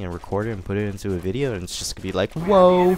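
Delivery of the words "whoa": loud, high delighted exclamation; excited whoa. Pretend. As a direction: blurt it out like a thrilled fan. Imagined excited reaction to dual commentary.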